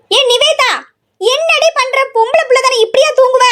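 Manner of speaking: high-pitched nagging call to the sleeping daughter; scolding call. Acted. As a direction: call her out of bed, scolding. Mother calling daughter awake. (உரத்த அதட்டல் குரல்)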